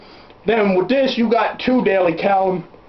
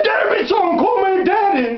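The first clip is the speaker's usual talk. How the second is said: high-pitched mock scolding; 8 semitones higher than usual. Father scolding son in retold story.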